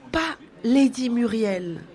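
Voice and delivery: put-on a child begging him to spare Lady Muriel; pleading child. A narrator's reading. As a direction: plead that it can't be her. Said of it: "d'un ton suppliant".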